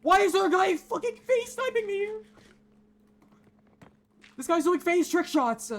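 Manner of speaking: high, fast incredulous yelling; outraged disbelief. Real, not acted. Gamer yelling at an enemy sniping him, high and fast. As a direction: rant at the sniper in total disbelief. Sniped repeatedly by enemy player.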